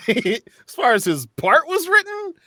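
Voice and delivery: "as far as his part was written" said through a laugh, pitch jumping up; laughing restart. Real, not acted. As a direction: laugh your way back into the sentence. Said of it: "restarts the same phrase laughing".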